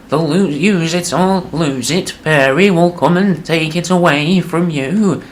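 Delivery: put-on high, slow old man voice; wavering old dad, dragging every word; doddering old man. Old dad to grown son at bedtime, high slow quaver.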